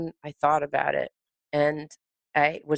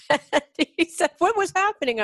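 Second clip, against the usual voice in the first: laughing; 11 semitones higher than usual. Real, not acted. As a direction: still laughing, much higher. Retelling while still laughing.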